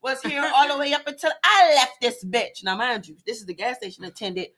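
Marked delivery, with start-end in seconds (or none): 0.0-2.6 higher, excited Jamaican-tinged gossip; attendant's voice. Retold or imitated. The attendant telling the story while pumping gas, Jamaican accent. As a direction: spill it eagerly in her Jamaican accent. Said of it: "this is literally exactly how she's talking".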